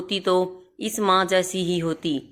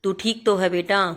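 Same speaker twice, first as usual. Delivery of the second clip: plain reading voice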